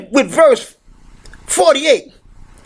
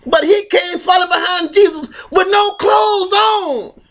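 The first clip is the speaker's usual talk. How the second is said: fiery preaching; 4 semitones higher than usual. Acted. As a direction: preach it hard at the crowd. Preaching at full force.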